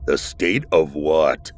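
low, slow suspicious creature voice. Acted. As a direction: lage wantrouwige stem. Creature demands an answer.

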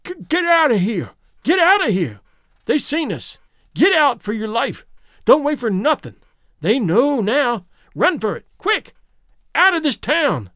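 panicked, trembling urgent cry; trembling whisper. A narrator's reading. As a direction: beg him to run, terrified. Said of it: "as a trembling whisper".

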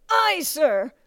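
high, strained shout back; recruit's reply. A narrator's reading. Recruit to bellowing drill master, strained shout.

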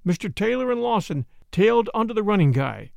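plain reading voice

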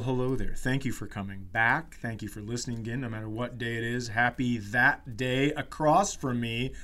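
normal talking voice

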